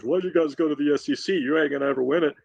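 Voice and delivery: sneering mock of a Texas fan; mocking rival fan. Mocking an Aggie fan about joining the SEC, lightly sneering. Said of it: "an Aggie fan that has heard".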